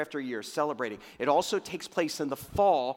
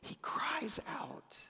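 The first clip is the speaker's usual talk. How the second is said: slow, airy stage whisper; dramatic whisper; 12 semitones higher, 11 dB quieter, much slower than usual. Real, not acted. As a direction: whisper it slowly, stretching the words. Hushed climax after loud preaching.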